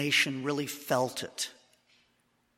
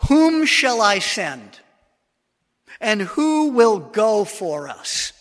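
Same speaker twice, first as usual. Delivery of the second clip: slow, louder, higher and solemn; the Lord's solemn call, higher, louder and slow; 5 semitones higher, 10 dB louder, slower than usual. Acted. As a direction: call out like God asking the heavens. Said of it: "I heard the voice of the Lord saying".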